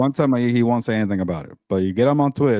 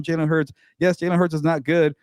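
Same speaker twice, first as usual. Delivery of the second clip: normal talking voice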